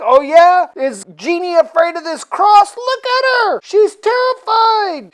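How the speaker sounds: very high, loud mock shouting; hammed-up triumphant challenge. Proving to a friend that Jeannie fears the cross, mock shouting. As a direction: challenge loudly, climbing to a high peak.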